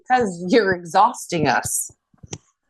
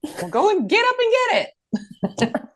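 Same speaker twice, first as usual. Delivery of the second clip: very high mock outburst; exasperated mom. Mom telling her son to fetch it himself, exasperated. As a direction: imitate a parent out of patience.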